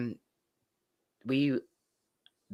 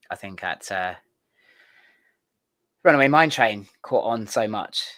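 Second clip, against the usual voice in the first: ordinary delivery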